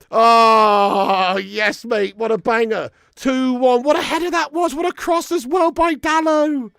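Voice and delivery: loud, high delighted praise; joyful replay gush. Real, not acted. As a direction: savour the goal out loud. Reliving the goal replay.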